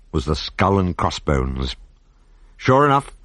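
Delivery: ordinary delivery, nothing marked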